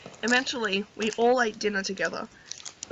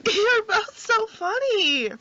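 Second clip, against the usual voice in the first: breathless laughing; 10 semitones higher, much slower than usual. Real, not acted. Slow breathless after laughing.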